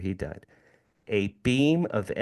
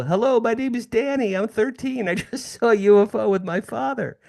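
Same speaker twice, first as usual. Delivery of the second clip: put-on high, eager boy's voice, much higher; young boy; 11 semitones higher, 3 dB louder than usual. Boy phoning the newspaper to report a UFO, high and eager. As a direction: play the thirteen-year-old reporting a UFO, pitched way up. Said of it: "I go".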